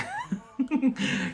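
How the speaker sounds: high giggle while teasing; real laugh. Real, not acted. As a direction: break into a high chuckle.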